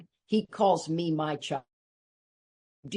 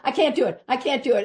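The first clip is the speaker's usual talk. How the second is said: panicked refusal; 6 semitones higher, 5 dB louder, faster than usual. Retold or imitated. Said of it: "She goes".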